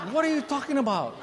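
incredulous son. Retold or imitated. Boy baffled at his dad, high disbelief. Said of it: "he looked at me like".

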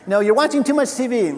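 mock nagging. Nagging someone glued to the TV, mock scolding. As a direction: scold someone for lazing about. Acting a nagging family member.

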